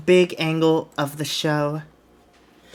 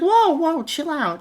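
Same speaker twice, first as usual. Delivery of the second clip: high startled "whoa", easing off; placating; 10 semitones higher than usual. Acted. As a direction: back him off in a high voice. Acted promoter calms angry wrestler.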